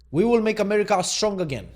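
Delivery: loud, high rally cry, accidentally Russian-sounding; Russian-sounding Trump. Acted. Vlogger's failed impersonation coming out Russian, proclaimed loudly. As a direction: proclaim a slogan with a faintly Russian accent. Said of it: "I sound Russian".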